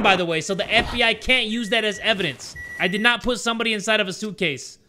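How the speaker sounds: mock protesting. Gamer denying a crime to the FBI, mock protesting. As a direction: plead innocence in a hammed-up way.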